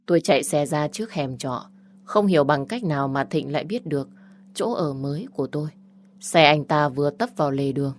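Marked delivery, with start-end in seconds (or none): none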